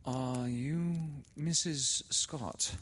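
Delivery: put-on high, slow, hesitant girl's voice; Laura, timid. A narrator's reading. A nervous girl at the dark doorway asking timidly. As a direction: ask it high and slow, scared. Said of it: "Laura said".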